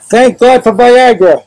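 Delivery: loud, high, drawn-out yell; triumphant retold shout. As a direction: holler it out slowly for the whole room. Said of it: "And I yell out".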